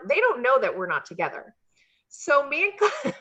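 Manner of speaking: normal talking voice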